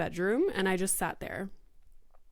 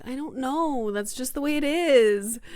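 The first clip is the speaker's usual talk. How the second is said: higher, louder fed-up answer; exasperated parent; 5 semitones higher, 5 dB louder than usual. Retold or imitated. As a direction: throw up an exasperated answer to a child. Exasperated parent answering child's questions.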